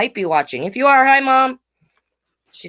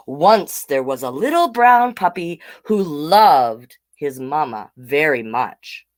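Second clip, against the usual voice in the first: slow, gentle storybook reading; reading to children; 5 semitones lower, slower than usual. A narrator's reading. Host reading the picture book to kids, slow and gentle. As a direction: read it slowly like to little kids.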